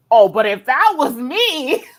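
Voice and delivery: high, loud put-upon protest; mock grievance. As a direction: imitate an aggrieved sibling crying unfair. Family's envious complaint imitated.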